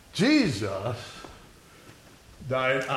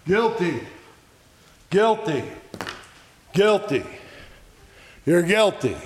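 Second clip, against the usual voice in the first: higher-pitched repeated verdict, each one separate; crying out; 3 semitones higher than usual. Acted. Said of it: "big megaphone that's crying out".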